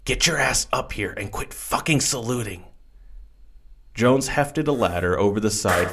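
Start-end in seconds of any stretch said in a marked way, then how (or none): 0.0-2.6 whispered bark. A narrator's reading. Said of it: "somehow both whispering and barking at the same time".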